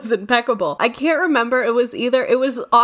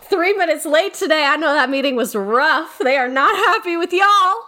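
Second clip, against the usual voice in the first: teasing; 6 semitones higher than usual. Retold or imitated. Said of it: "they'd be like".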